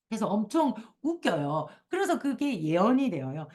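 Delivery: ordinary delivery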